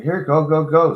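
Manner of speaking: urging. Retold or imitated. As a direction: wave her in fast. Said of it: "So I was like".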